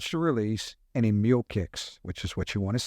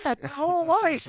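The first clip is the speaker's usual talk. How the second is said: much higher, pleading disbelief; exasperated plea. Retold or imitated. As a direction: beg him to grasp how big this was. Exasperated reply to dad.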